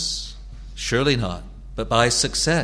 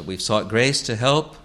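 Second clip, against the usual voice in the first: ordinary talk, nothing marked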